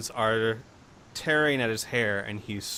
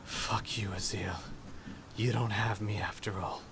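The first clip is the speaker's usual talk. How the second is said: hushed, lower, near-whispered murmur; very quiet; 3 semitones lower, 7 dB quieter than usual. Acted. Man lying on his back after agony to Azil, very quiet. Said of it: "you hear very quietly".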